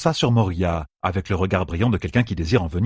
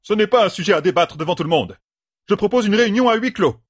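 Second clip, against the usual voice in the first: fast, high-pitched agitated objection; nervous protest; 12 semitones higher, 4 dB louder, faster than usual. A narrator's reading. A nervous sister objects to debating in public, agitated. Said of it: "la nervosité faisait ressurgir son accent".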